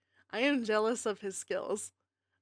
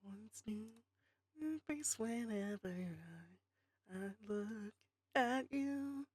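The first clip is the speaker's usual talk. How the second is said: whispering; 13 dB quieter, much slower than usual. Real, not acted. Whispered aside to chat.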